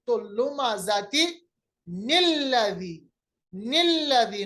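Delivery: लुमाजाती निल्लदी drawn out, nun carried over; slow joined recitation. Shown as a demonstration. Teacher showing the second example joined slowly. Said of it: "नून जेर नी बनाया".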